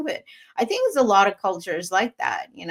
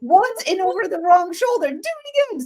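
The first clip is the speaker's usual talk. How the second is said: mock scolding; 9 semitones higher than usual. Retold scolding husband.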